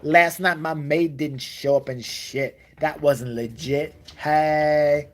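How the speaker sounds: slow, loud mock-rapper swagger; wannabe gangster rapper. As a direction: parody a rich kid acting street. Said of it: "gonna be a gangster rapper".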